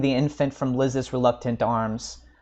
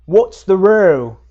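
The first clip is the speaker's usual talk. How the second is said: loud, higher drawn-out brute's bellow; demanding; 5 semitones higher, 11 dB louder, slower than usual. A narrator's reading. Drunken man demands of the loafers, loudly. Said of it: "he demanded quickly".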